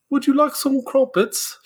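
high-pitched, badly faked English accent; mock English mother in a high voice; awful English accent. Mother in England offering crumpets, badly faked English accent.

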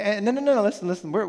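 ordinary talk, nothing marked

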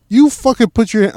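loud, high, indignant outburst; mock angry retort. Speaker retelling snapping back at a cop, loud mock outrage. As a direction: bark it back at the cop, half-joking.

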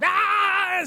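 high piercing mock toddler scream; child's tantrum scream. Imitating a toddler's tantrum in a stroller, mock scream. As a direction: shriek like a kid in a meltdown.

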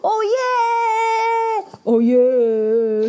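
loud whoop of victory; cheering. Acted. Ferrets cheer after the battle, loud whoops. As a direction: cheer like you just won.

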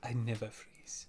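put-on slow, soft African accent; African businessman. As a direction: echo it quietly in an African accent. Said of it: "like an African businessman".

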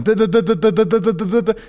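high rapid mock buh buh buh; button-mashing noises. Telling of jabbing the help button on a plane, a high mock buh-buh. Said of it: "just punching it a hundred times".

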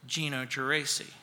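low, very quiet name drop; understated punchline, low and soft; hushed deadpan reveal. Acted. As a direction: drop the name softly as the verdict. Announcing himself as the vote winner.

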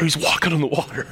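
hushed, whispered amazement; awed whisper. Real, not acted. Preacher marvelling at Peter on the water, whispered. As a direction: whisper it in wonder. Awed aside after wondering about details.

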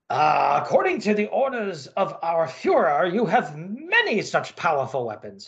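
put-on gruff German officer, German accent; comic-book Nazi colonel, German accent. Colonel speaks.

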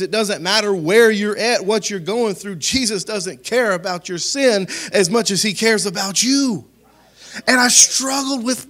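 high, loud, driving sermon delivery; full-force preaching. Real, not acted.

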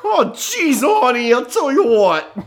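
high, loud comic exclamation, drawn out at the end; hammed-up fluster. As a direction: exclaim it way up high, playing overwhelmed. Continues the flustered comic rant.

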